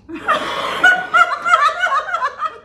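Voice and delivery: shrieking laugh. Real, not acted. After the burst joke.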